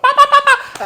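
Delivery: high, loud rapid-fire babble imitating Jamaican ranting; mock Jamaican rant. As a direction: spit out nonsense syllables as a mock Jamaican tirade. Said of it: "talking in that Jamaican accent".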